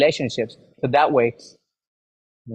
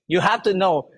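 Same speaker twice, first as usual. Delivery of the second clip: ordinary talk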